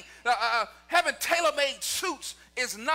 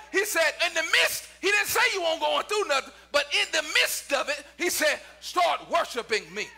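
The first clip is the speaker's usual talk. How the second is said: raised, urgent pulpit exhortation; impassioned preaching; 4 semitones higher than usual. Acted. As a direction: press each hardship toward the refrain. Preaching at full force.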